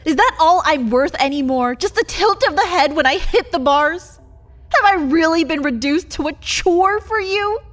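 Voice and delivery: loud, bitter rising protest; angry outburst. Acted. Caged vampire angrily confronting her silent partner. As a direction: hurl it at him through the bars.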